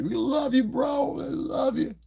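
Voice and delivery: high falsetto gushing fake-friend voice; mock flattery. Mocking industry people who claim love, in high falsetto.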